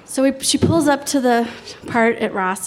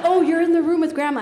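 realizing; 7 semitones higher, 4 dB louder, faster than usual. Retold or imitated. Mom realizes on phone.

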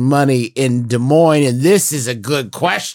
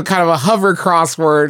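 usual speaking voice

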